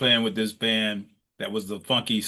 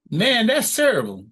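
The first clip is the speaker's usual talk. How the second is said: mock teasing jab; 10 semitones higher, 6 dB louder than usual. Quoting the sax player's tease.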